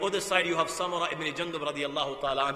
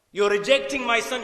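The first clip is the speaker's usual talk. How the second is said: raised, higher-pitched challenge; forceful acted disbelief; impassioned protest; 5 semitones higher, 5 dB louder than usual. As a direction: repeat it louder, as a pleading father's objection. Father repeats protest with force.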